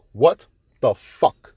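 slow, stretched-out disbelief; stunned disbelief. Real, not acted. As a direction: drag it out, still processing the shock. Repeats reaction, slower.

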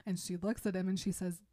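ordinary talk, nothing marked

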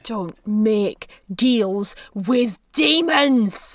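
very slow, word by word; slow, stubborn defiance; defiant refusal. Acted. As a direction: refuse with each word weighed. Captive refuses the offered deal.

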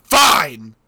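a sudden loud mock shout of Fine; hammed-up exasperated yell; mock outburst. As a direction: bark it out in fake indignation. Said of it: "I was like".

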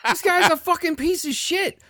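very high-pitched indignant squawk; worked-up retold rant, voice shot up; angry outburst. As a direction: re-enact an outraged verdict. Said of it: "I was so angry".

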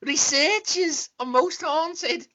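high, slow mock excuse voice; mocking Derek Acorah. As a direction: drag out the excuse in a high put-on whine. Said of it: "he blamed it on Sam".

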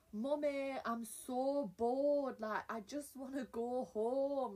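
high, very slow drawn-out whine; whiny bored kid. Pretend. Showing off her accents with a bored kid whine. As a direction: drag it out like a bored child. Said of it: "I do love doing accents".

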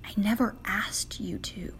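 soft, hushed divine answer; gentle retold reply, barely voiced; God's reply. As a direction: answer quietly, voicing God. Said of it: "he replied with".

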